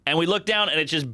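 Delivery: ordinary talk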